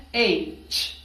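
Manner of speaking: ایچ slow, as "eyy-ch"; correct English H. Shown as a demonstration. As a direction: stretch the vowel of ایچ, then release "ch". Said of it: "آپ نے اس کو پروناؤنس کرنا ہے".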